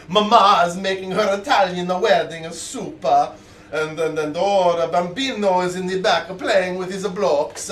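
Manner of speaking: hammed-up Italian accent. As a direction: put on a playful Italian accent. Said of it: "a nice Italian accent".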